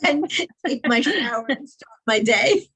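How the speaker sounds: words broken up by laughter; laughing while talking. Real, not acted. Laughs while listing own plans.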